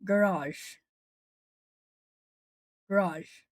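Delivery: American "garage" as guh-RAHZH; American pronunciation. Shown as a demonstration. Streamer showing American English, "garage" as guh-RAHZH. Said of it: "American English sounds like".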